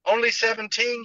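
mourning maid wails; wailing. Acted. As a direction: cry out the age like a lament. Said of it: "she wailed".

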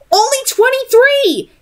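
stunned outburst; astonished. Acted. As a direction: gasp it out in disbelief. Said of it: "Tessa was astonished".

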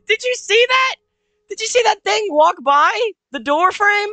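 high, loud, shaken-up exclaiming; loud, startled. Real, not acted. Player asking if viewers saw the thing, loud and startled. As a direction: exclaim in high-pitched disbelief after a scare. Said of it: "I'm sorry if I'm loud".

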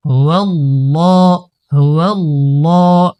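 usual speaking voice

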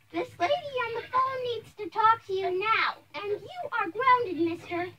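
stern scolding. Acted. Mother handing her son the phone and grounding him, cross.

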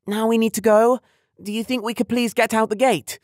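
high, loud impatient pleading. A narrator's reading. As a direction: hurry her dad out. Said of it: "growing a bit impatient".

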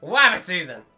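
loud "Wabbit" with w for r; cartoon w for r. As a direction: shout "Wabbit" back in a cartoon squabble. Cartoon season argument.

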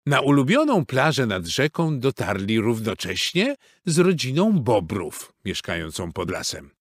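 usual speaking voice

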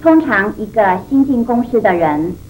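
ordinary talk

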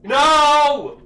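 long, high, loud wail of refusal; terrified shout. Real, not acted. Player refusing what the horror game shows, terrified shout. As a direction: cry out in sudden horror.

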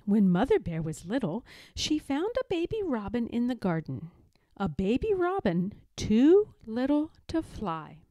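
plain reading voice

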